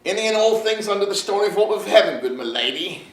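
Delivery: put-on fast, high, nervous country accent; a young bumpkin blurting out grand words in his country accent; nervous rustic accent. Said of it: "laughed at my accent".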